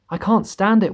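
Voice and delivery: high, anguished wail on stand. A narrator's reading. Earthworm wailing to the others, high and anguished. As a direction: moan it out in misery. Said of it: "wailed the earthworm".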